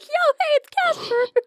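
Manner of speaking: breezy high-pitched ghost greeting; Casper. Acted. Acts out Casper casually coming back.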